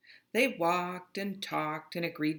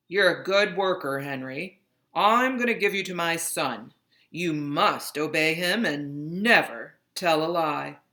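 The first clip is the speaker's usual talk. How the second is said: put-on louder, commanding old master voice; dying master; 6 dB louder than usual. Dying master handing Henry to his son. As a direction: give the order from the sickbed. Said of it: "But the master said".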